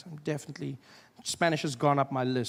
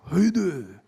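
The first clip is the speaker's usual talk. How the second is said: chest voice; 2 semitones higher, 7 dB louder than usual. Shown as a demonstration. Showing Japanese is spoken from the chest, loud. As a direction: say it from your chest, strong. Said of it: "speak Japanese from your chest".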